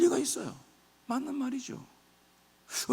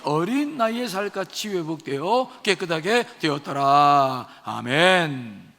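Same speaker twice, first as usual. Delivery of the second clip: measured unison-reading voice, drawn out at the close; scripture reading; 5 semitones lower, 4 dB louder, slower than usual. Acted. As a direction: lead the congregation through the verse solemnly, stretching the final words. Leads congregational scripture reading. (성경을 낭독하는 엄숙한 목소리)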